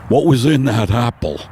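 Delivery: a dazed horse struggling to breathe; Mister Ed on acid. Acted. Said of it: "my impersonation of Mister Ed".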